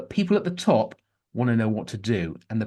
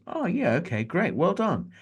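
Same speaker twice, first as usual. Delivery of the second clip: mock bland approval; 7 semitones higher, slower than usual. Mock agreement of colleagues.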